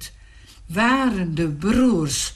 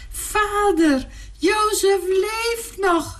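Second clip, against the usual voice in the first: high, ringing call to their father; excited calling out; 11 semitones higher, slower than usual. Acted. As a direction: call out the joyful news, high. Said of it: "riepen ze".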